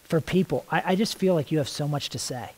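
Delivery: earnest spoken prayer, drifting soft and hushed as it goes on; heartfelt prayer. Real, not acted. Praying to the Lord before the group, intimate and hushed. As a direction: pray it out, pausing to listen.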